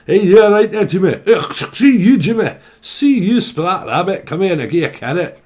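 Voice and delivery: loud, high Scottish banter; exaggerated broad Scottish holler; hammed-up Scottish accent. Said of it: "You could be from Scotland".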